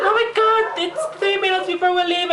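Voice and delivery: high, frantic mock panic. Imagined self panicking minutes before leaving, high mock panic. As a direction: pretend you're late and can't find it. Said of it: "and then you're like".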